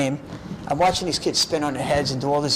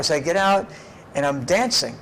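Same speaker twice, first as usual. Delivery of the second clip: normal talking voice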